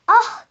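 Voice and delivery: loud, high yelp of pain; sudden sharp "ah" of hurt; pained cry out. A narrator's reading. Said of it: "diye bağırmaya başlamış". (acıyla bağırma)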